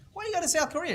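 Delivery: squeaky high disbelieving question; incredulous. Retold or imitated. Mentor quizzing the kid about Korea, squeaky disbelief. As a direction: pitch it up in amused disbelief.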